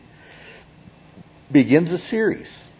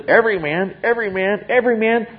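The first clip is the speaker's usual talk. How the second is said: every man rising higher each time; building emphasis; 10 semitones higher than usual. Acted. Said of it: "He says".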